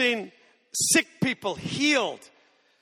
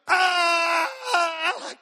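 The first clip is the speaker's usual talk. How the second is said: higher, louder hammed-up screams; retold girlish screams, higher and louder; mock scream; 8 semitones higher, 6 dB louder, much slower than usual. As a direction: imitate her screaming as she runs. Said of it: "She screamed".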